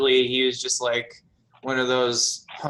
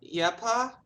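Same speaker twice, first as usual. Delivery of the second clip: high, rising timid answer; meek daughter; 12 semitones higher, 3 dB quieter than usual. Acted. Daughter answers her pa's shout, meekly. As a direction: answer meekly, pitched up into a question. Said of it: "she kinda meekly comes out".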